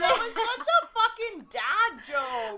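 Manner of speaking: loud laughing boo; mock booing. Real, not acted. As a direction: boo the joke, laughing hard. Booing a bad joke.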